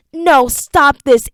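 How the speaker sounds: high, loud, slow protest; desperate plea. Acted. Enoch gagging in the darkness, begging them to stop. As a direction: push back in panic.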